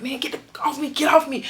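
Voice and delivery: mock struggle yell. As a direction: shove the words out like you're wrestling someone off you. Fighting off an officer.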